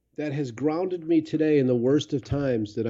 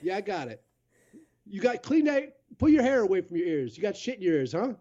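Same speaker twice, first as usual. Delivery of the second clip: helpful local guy; 6 semitones higher than usual. Retold or imitated. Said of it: "and they're like".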